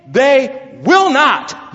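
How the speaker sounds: "they will not" slowed right down with weight on "will"; emphatic, drawn-out "they will not". Shown as a demonstration. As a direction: lean hard on "will", stretch "they will not" slowly. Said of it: "But this is an emphatic".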